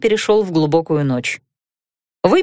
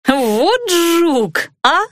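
laughing; 8 semitones higher, much slower than usual. A narrator's reading. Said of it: "расхохоталась Ариса".

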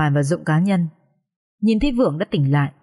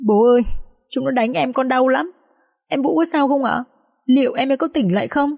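high, pleading son in tears; wounded plea in a raised pitch; tearful, aggrieved; 6 semitones higher than usual. A narrator's reading. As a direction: appeal to your father, hurt and high-pitched. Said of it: "ấm ức đến rơi nước mắt".

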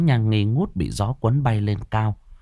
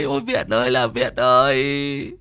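grieving lament; 3 semitones higher than usual.

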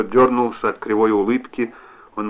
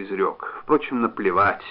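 ordinary delivery, nothing marked

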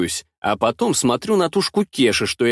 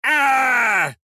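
a sudden shrill squawk, much higher; parrot's panicked squawk; 11 semitones higher than usual. Acted. The revived parrot waking mid-strike, panicked squawk. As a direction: squawk in shock. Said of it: "начинает кричать".

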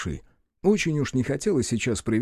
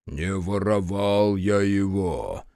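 put-on low, slow old-man boom; basso Мороз; 4 semitones lower, much slower than usual. A narrator's reading. Мороз denies to Рух in a slow low boom. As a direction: boom it like an old frost spirit. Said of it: "пробасил Мороз".

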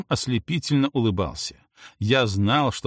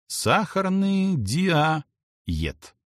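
very slow, higher-pitched reveal; drawn-out reading; 6 semitones higher, much slower than usual. A narrator's reading. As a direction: stretch each word out in realization. Reading out the puzzle answer.

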